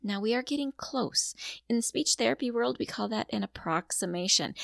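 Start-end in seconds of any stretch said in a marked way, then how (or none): none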